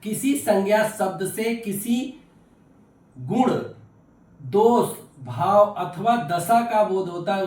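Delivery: slow definition with long pauses between key terms; emphatic dictation. Real, not acted. Teacher dictating a new definition to the class, emphatic dictation. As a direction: set each term apart, deliberately.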